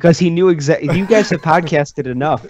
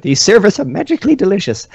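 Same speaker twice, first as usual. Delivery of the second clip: put-on Irish accent; 7 semitones higher than usual. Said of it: "I can't do an Irish accent".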